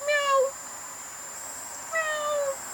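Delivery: very high soft meowing; cat meow. Pretend. Pretending to be a cat to friends, high meow. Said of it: "I'll be like".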